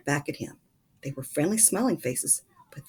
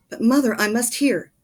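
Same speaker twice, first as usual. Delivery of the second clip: higher, louder begging; pleading child; 7 semitones higher, 7 dB louder than usual. A narrator's reading. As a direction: beg to stay up. Charlie begging to stay up.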